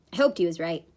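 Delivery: usual speaking voice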